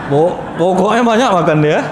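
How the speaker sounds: ordinary delivery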